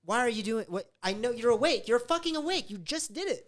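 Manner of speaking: high, loud accusing rant; furious. Retold or imitated. Partner accusing her of faking sleep, high and furious. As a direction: refuse to buy her excuse. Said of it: "I was really, really mad".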